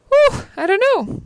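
high, loud puff of helplessness; acted overwhelm. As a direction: play daunted, voice jumping up high. Acting overwhelmed by a massive problem.

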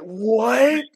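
normal talking voice

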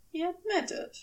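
usual speaking voice

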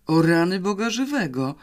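ordinary delivery

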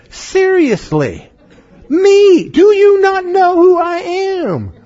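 hammed-up swagger facing down demons; exaggerated defiance. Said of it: "We should have the attitude of".

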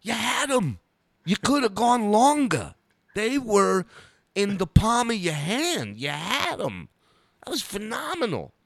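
high, emphatic gushing praise impression; Dice. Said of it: "He goes".

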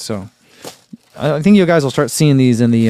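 ordinary talk, nothing marked

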